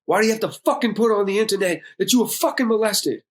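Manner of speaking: indignant high-pitched demand; angry outburst. Retold or imitated. Dad confronts son over internet post, angry.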